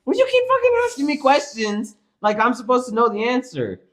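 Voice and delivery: high, exasperated retold rant; yelling at mom. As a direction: fire back at mom, fed up. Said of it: "Stop yelling at me".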